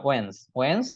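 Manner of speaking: "ওয়েন্স" as one syllable, wenz; correct first syllable. Shown as a demonstration. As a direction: say "ওয়েন্স" with silent d. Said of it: "এটার right উচ্চারণ".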